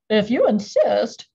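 loud, odd crustacean voice; gruff sea creature accepting; lobster voice. A narrator's reading. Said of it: "said the lobster".

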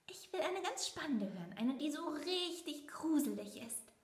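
put-on high, excited little boy; a small mouse eager for scares; child mouse voice. A narrator's reading. Leo asks his mother for a scary story.

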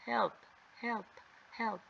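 short echoes, each dying away; fading echo. Acted. Girl's cry bouncing round the cave, echoing. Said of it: "heard her voice echo throughout".

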